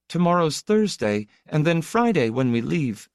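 put-on high, light child's voice; young boy. A narrator's reading. Little boy counting off the days to his sister, childlike. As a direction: voice a little boy, pitched well up.

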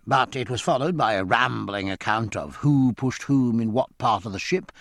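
usual speaking voice

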